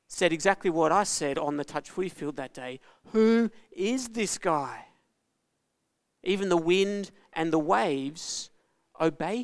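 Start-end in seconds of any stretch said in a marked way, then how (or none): 3.1-4.9 higher, slow amazed question; stunned friends, slow and higher; awed wonder. Retold or imitated. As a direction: let the awe stretch each word. Friends awed after the calm.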